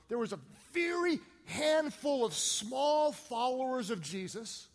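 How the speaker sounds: slow, punched phrases with big pitch swells; emphatic preaching. Real, not acted. Preacher telling how faith spread worldwide, emphatic preaching. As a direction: drive each key word home to the congregation. Preacher builds to main point.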